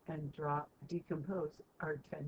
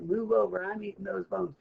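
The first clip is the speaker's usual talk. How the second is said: louder, pushy dog shoving in; a dog elbowing the others aside, much louder; louder, bossy dog claiming the bones; 2 semitones higher, 9 dB louder than usual. Retold or imitated. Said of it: "Rover's like".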